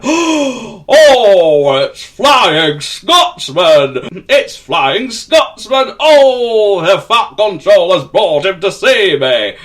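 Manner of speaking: thrilled beyond words; overjoyed. Acted. Gordon overjoyed at Scotsman.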